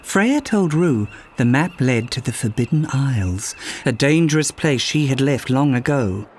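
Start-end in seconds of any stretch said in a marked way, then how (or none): none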